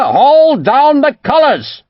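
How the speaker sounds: slow, high, loud furious bellow; angry bellow. A narrator's reading. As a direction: roar each word out at the officer. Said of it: "Cromwell bellowed angrily at Tufnell".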